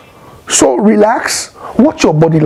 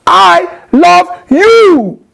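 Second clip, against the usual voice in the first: much higher and louder, slow and cutting; harsh tone; 11 semitones higher, 5 dB louder, much slower than usual. Shown as a demonstration. Arguing with a spouse, a high, loud, slow I love you. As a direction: snap it at your spouse. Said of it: "it's not the same thing as".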